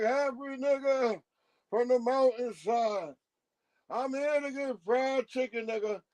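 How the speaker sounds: mock the lofty conscious orator. A lightly voiced lofty orator, spoken to regular people. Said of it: "if I was talking like that all day".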